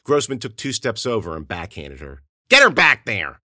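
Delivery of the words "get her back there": loud, high barked order; angry bark. Acted. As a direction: yell the order at his men. Grossman orders, after backhanding.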